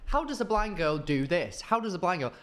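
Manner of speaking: sing-song video-opener hook, lightly mimicked; imitating TikTok intro. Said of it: "her voice would always start with".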